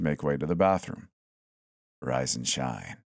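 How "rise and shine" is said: lower, slow and soft; a doll greeting you eerily; creepy low mannequin voice. A narrator's reading. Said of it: "said the mannequin".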